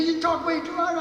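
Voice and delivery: high mock scolding quote; squeaky imitation of her scolding; quoting the producer. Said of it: "she said you talk too much".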